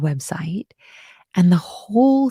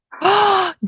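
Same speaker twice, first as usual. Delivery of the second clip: loud, high gasp of surprise; surprised gasp; 12 semitones higher, 6 dB louder than usual. Real, not acted. Teacher seeing her live code test result, surprised gasp.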